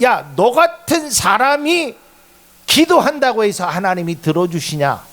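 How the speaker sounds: acted friend jeering at Job; mocking taunt. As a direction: mimic a scornful friend. Job's friends taunting him.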